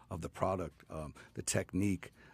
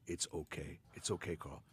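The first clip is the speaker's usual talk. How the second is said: soft reassurance; 3 semitones lower, 10 dB quieter than usual. Retold or imitated. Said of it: "and said".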